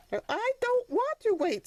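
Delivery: a little donkey stamping his foot; young donkey protesting. Acted.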